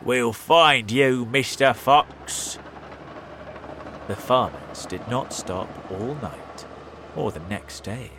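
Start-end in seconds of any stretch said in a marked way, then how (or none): 0.0-2.6 slow, louder threat; menacing farmer. A narrator's reading. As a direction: promise to catch the fox.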